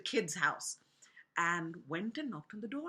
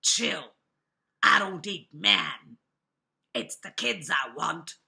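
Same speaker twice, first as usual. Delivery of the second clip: big bad wolf; 4 semitones lower, 8 dB louder than usual. A narrator's reading. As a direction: speak low and heavy as the wolf. Wolf speaks to the piano tuner.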